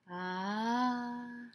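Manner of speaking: plain reading voice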